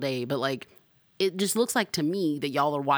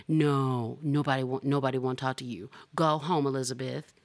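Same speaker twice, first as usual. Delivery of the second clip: usual speaking voice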